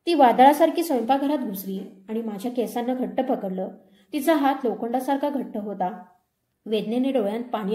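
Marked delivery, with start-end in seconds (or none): none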